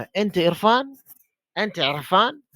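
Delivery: अंत Irfan with a rising question tune on Irfan; asking tone. Shown as a demonstration. Teacher asking a student if he is Irfan, rising question. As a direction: ask अंत Irfan with the pitch going up. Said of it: "मैं पूछ रहा हूँ".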